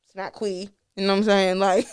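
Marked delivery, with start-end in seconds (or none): 0.3-0.7 "Quee" said with a long ee ending; wrong pronunciation. Shown as a demonstration. Ruling out a name's sound, "Quee" with a long E. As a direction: say "Quee" to rhyme with "see". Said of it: "'cause it's not an E".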